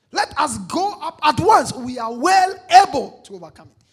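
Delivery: loud confident declaration; bold speaking. Acted. Caleb urging Israel to take the land, bold and loud. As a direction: proclaim it with full conviction. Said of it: "Bold speaking".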